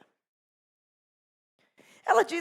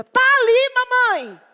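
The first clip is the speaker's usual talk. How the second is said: put-on high, loud insolent child's voice; arrogant child; 15 dB louder, slower than usual. Boy insolently pointing out the man to his mother. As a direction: say it like a cocky little boy. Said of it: "Todo arrogante a criança".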